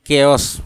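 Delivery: केयस with hard K, 'kay-os'; Indian English pronunciation. Shown as a demonstration. Teacher trying it for learners, acceptable Indian English pronunciation. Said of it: "Indian English में थोड़ा मैं कोशिश करता हूँ".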